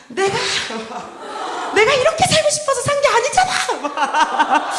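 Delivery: high, drawn-out mock-angry wail; worked-up anger. Congregant lashes out at the pastor over their life, hammed-up anger. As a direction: shout it loud and high, hamming up the outburst. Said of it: "내 스스로가 격양이 돼서 목사님을 향해서 막 화를".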